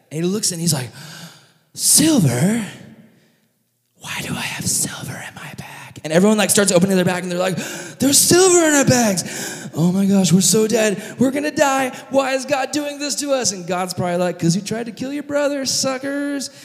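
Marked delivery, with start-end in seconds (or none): 1.8-6.0 high gasp sinking to a whisper; hushed mock bewilderment; stunned whisper. Dan discovers silver in his bag. 8.0-13.5 high-pitched frantic wail; hammed-up hysterical brothers; mock panic. Brothers panic on finding silver. 14.4-16.5 high, sing-out jeer; comic gloating in a raised voice; mock taunt. Imagined God taunts the brothers.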